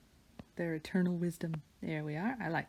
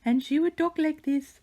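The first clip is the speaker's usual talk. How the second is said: much higher, louder cutesy voice for the cat; high-pitched pet voice; 10 semitones higher, 6 dB louder than usual. Shown as a demonstration. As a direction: squeak it out like a pampered cat talking. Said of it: "I had a high-pitched voice for her".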